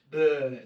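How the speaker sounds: "Bern" said the Swiss way; local pronunciation. Shown as a demonstration. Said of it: "that's how they say".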